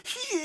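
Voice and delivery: mock wail. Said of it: "えーって泣いて".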